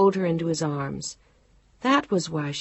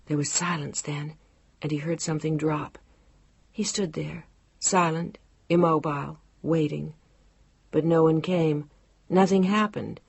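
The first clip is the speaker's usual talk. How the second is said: plain reading voice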